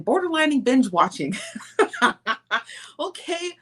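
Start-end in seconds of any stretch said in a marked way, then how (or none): 1.4-2.9 high, amused burst of laughter; real laugh. Real, not acted. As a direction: genuine tickled laugh. Laughing at viewer's binge-watching comment.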